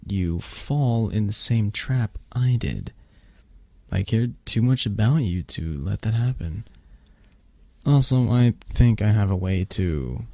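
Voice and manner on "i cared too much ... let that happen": usual speaking voice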